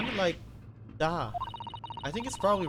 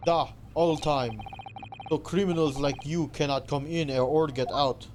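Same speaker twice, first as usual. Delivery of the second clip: put-on loud, boastful Russian accent; Russian sailor accent; 6 dB louder, slower than usual. Sailor boasting about the first class area, Russian accent. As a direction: puff up like a guard in Russian accent.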